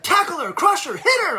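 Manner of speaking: high, loud shouted commands; imitating a pushy parent shouting; mock sideline yell. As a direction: yell it like an overeager dad. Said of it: "he's like".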